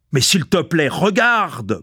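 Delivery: high-pitched pleading shout, held back; mild bawl. A narrator's reading. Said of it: "brailla Tchoukov".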